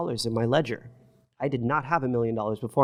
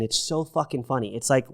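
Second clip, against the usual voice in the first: ordinary delivery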